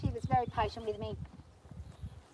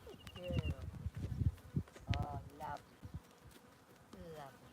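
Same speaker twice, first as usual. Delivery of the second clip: soothing horse praise; 9 semitones lower, 10 dB quieter than usual. Real, not acted. Soothing horse while riding.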